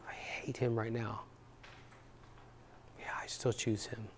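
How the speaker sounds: soft whisper, almost no voice; whispered admission. Retold or imitated. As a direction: breathe the words out after tears. Bride answers after crying, calming down.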